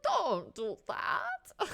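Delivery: high, loud, drawn-out mock character voice; hateable Apparat voice. Host imitating the audiobook narrator's hated villain, hateable voice. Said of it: "does the apparat's voice".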